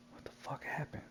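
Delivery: whispered, bewildered question; dazed whisper. Retold or imitated. Waking up on the side of a building, dazed whisper. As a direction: whisper it like someone just coming to.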